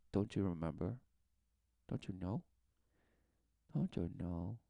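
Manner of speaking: soft, low, coaxing questions; hushed reminder. Acted. Guy reminds someone in denial, hushed and coaxing. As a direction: prompt gently in a hushed voice.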